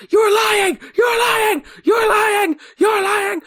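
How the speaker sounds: shrill imitated child's scream, voice breaking; little kid scream. Host imitating Will over and over, high breaking kid scream. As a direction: scream it repeatedly, very high and cracking. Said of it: "this little kid scream with the, the voice breaking".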